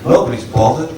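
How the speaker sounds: ordinary delivery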